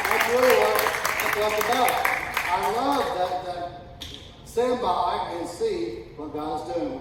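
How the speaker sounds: loud, high, fervent pulpit preaching; preaching at full force. Acted. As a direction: preach it out to the whole congregation.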